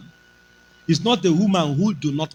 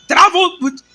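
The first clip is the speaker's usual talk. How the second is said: high, loud indignant echo; scolding boss; 11 semitones higher, 6 dB louder than usual. Retold or imitated. Boss scolding the pastor, loud high echo. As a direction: throw his excuse back at him.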